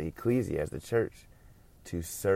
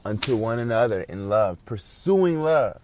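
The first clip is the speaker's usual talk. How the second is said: climbs high and loud, drawing out the last words; rising preaching; 5 semitones higher, 8 dB louder than usual. Real, not acted. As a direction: preach it up to a peak. Preacher swells to climax.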